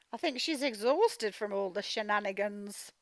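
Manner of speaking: ordinary talk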